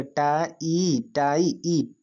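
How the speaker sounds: टा and ई slowly held apart; slow, split-up टाई for learners; sounding out syllables. Shown as a demonstration. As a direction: stretch टा, then a long ई. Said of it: "ये बोले, क्या बोले".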